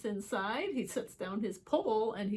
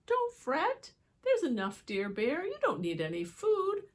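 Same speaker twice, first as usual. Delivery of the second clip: lightly high mouse voice; a hint of a tiny squeaking mouse; squeaky mouse; 8 semitones higher than usual. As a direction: mouse comforting the bear. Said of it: "Mouse squeaks".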